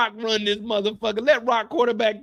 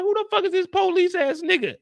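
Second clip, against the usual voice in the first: high mock confusion; 10 semitones higher than usual.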